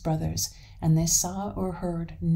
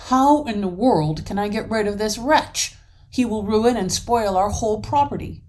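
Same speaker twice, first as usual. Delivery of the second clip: exasperated troll; 4 semitones higher, 6 dB louder than usual. Acted. Troll complaining angrily to wife.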